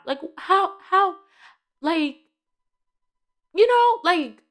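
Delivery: high-pitched, exasperated puzzlement; baffled. Real, not acted. Fan puzzling over fate in the plot, baffled.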